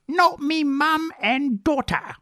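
high, slow, hammed-up British accent; bad British accent. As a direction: draw it out in a silly British accent. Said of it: "I will not use that accent anymore".

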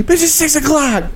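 high mock retort; mock snapping husband. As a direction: snap back like an angry husband. Acted husband snapping back.

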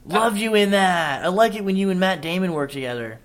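gushing, high-pitched fake fan voice; starstruck fan. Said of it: "I'm like".

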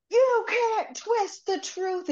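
high, slow, drawn-out protest; retold indignant outburst. Rach confronting him on set, mock indignant. Acted quarrel retold from book.

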